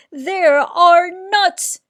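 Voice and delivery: loud, high and slow exclamation; exclaiming. A narrator's reading. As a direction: cry out the loss, dragging it. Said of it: "exclaimed Bruce".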